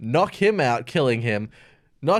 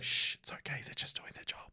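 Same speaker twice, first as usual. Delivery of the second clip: put-on hushed whisper to a baby; 16 dB quieter than usual. Beast hushes the crying baby, soft whisper.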